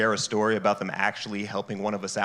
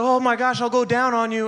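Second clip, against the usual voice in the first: higher, eager mock girl; 11 semitones higher than usual. Acted quote of girl.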